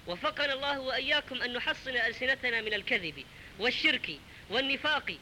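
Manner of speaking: ordinary talk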